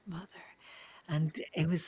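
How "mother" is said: soft whispered "mother, mother"; whispered calling. Retold or imitated. Dying young soldier calling nurse mother, barely whispered. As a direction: breathe the words like a dying boy's plea. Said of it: "he was calling me mother".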